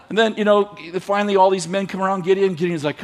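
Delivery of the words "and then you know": ordinary talk, nothing marked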